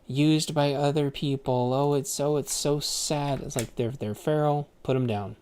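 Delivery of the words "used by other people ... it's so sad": high, loud, mocking sympathetic whine; mocking other people. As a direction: parody the bleeding hearts in a high, loud whine. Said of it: "and then other people go".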